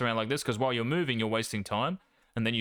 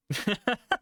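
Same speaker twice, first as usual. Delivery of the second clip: laugh; 10 semitones higher than usual. Real, not acted. Laughs between coaching points.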